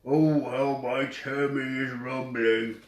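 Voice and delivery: slow, drawn-out giant's moan; lightly voiced giant lamenting an empty belly; hungry giant. A narrator's reading. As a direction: stretch the words out like a hungry giant.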